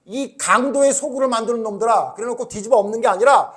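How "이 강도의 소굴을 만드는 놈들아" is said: loud, raised voice of Jesus in anger; angry rebuke. Retold or imitated. Jesus to the temple traders, angry rebuke. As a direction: denounce the robbers in the temple. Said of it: "이랬던 거예요".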